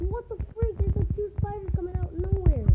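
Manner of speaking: high-pitched disbelieving complaint; alarmed. Real, not acted. Player reacting to spiders from nowhere, alarmed. Spiders suddenly appear in game.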